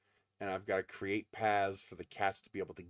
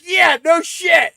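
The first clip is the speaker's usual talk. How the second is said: very slow, high and loud sarcastic yell; shouted sarcasm; 20 dB louder, much slower than usual. Retold or imitated. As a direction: drag it out, loud and mocking. Sarcastic retort to dad.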